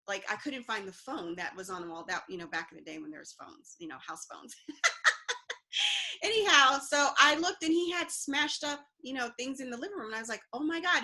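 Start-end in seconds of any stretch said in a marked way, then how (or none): 4.6-6.2 high chuckle; short, high burst of laughter; real laugh. Real, not acted. Chuckling after remark about house phones. 10.5-11.0 high-pitched, mildly panicked gasp of words; a touch of panic, voice raised high; retold panic. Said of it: "And then I panicked".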